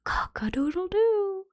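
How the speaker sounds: a hint of a rooster, still whispered; rooster crow. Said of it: "the cock stood upon the top of the well and cried".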